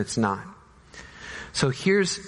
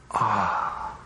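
pained cry; 6 semitones lower than usual. Acted. Reacting to the death news.

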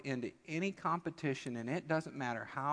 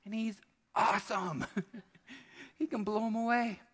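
lightly voiced proud kid; 7 semitones higher than usual. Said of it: "you're like".

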